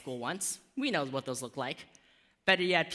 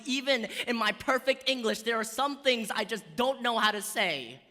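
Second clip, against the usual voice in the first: urgent pleading; 7 semitones higher than usual. Acted. Child pleading in poem.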